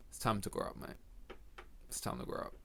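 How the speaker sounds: very soft, voiceless mock reproach; hushed disapproval. House guests judging his cheap freezer food, hushed disapproval. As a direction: murmur it like a disappointed visitor. Visitors judging him after seeing fridge.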